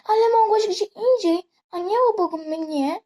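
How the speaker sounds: put-on jealous girlfriend's angry outburst. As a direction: snap at her for walking past. Jealous girlfriend quarrel in roleplay.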